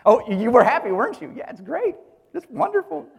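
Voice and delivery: high, mock-cheerful put-on delight; sarcastic. Preacher teasing listeners about their secret glee, high sarcastic cheer. Teasing those glad another was scapegoated.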